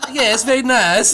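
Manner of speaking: slow, laughing put-on foreign twang; laughing, exaggerated foreign twang, slowly; mock foreign twang. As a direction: imitate a coworker's twang to Polish colleagues. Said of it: "but in a twang".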